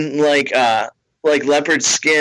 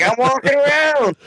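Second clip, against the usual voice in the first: put-on drunk lady fan; 11 semitones higher than usual. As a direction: call it out like a tipsy fan. Fan voiced.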